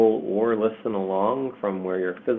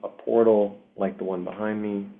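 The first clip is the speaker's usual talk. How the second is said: unhurried meditation delivery, long pauses between phrases; guided meditation. Acted. Meditation leader guiding listeners through a portal to Pandora, guided meditation. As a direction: lead listeners gently through an imagined journey, leaving space. Leads a guided visualization journey.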